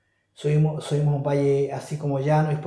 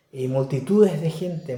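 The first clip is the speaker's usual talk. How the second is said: ordinary talk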